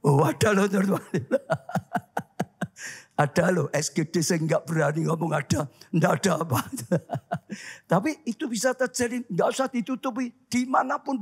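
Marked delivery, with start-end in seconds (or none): 1.1-2.9 soft breathy chuckle; amused laugh after a teasing remark. Real, not acted. Laughing at congregation's admission. 6.7-7.9 soft airy chuckle; quiet amused laughter. Real, not acted. Laughing after joking with congregation.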